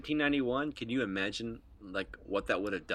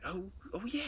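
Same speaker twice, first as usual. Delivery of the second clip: dawning realisation; 9 semitones higher, 9 dB quieter than usual. Retold or imitated. Acting out his sudden remembering.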